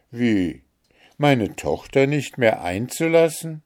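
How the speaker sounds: incredulous, mildly angry question rising in pitch; understated royal indignation; indignant cry. A narrator's reading. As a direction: challenge it as an offended mother. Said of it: "der Zorn rötete ihre Wangen".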